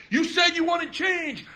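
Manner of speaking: high, drawn-out proclamation; mock rallying cry. Volunteer addressing his constituents on stage, high rallying cry. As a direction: proclaim it slowly to your followers.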